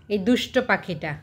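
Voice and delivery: ordinary delivery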